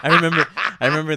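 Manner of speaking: loud, fast, through laughter; can't stop laughing. Real, not acted. Friend cracking up remembering the Starbucks scene. Laughing hard mid-story.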